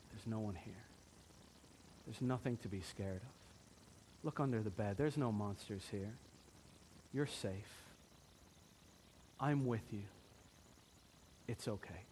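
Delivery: soft, low, gentle comfort; loving reassurance. Acted. Loving father comforting his scared daughter, soft and gentle. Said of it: "the loving father could do this".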